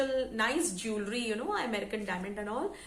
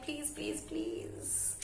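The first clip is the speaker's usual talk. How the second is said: coaxing plea; 3 semitones higher, 7 dB quieter, much slower than usual. Real, not acted. Storyteller begging viewers to subscribe, softly pleading. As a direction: beg softly, last word sinking.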